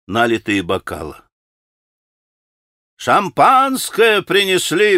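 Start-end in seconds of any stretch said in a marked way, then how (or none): 3.0-5.0 Rakitin calls out, slow and higher; shouted announcement. A narrator's reading. Rakitin announcing champagne to the room, calling out. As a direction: cry it out to the room. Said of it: "прокричал Ракитин".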